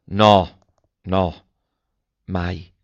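fading refusal. A narrator's reading. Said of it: "esclamò dessa".

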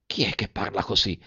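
hushed, fearful question; chilled with fright. A narrator's reading. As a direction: ask very softly, frozen by fear. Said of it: "sentendosi celare dallo spavento".

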